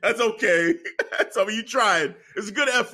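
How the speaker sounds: laughing imitation. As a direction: play the wrestlers apologising while cracking up. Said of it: "Johnny and Candice were like".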